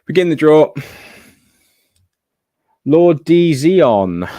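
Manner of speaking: loud, slow drawn-out reveal; hyped giveaway announcement. Acted. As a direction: announce the winner like a host. Announcing the redraw winner.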